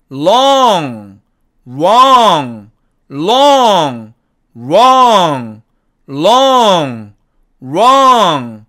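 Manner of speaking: long then wrong, alternated loud; long with a touching l, wrong with a curled r; l and r contrast. Shown as a demonstration. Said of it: "구분이 척척 잘 되도록".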